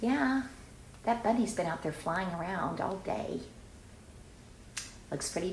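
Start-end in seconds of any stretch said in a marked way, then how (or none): none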